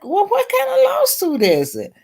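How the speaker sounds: high, indignant disbelieving questions; retold disbelief, high and indignant; baffled. Retelling her baffled questioning.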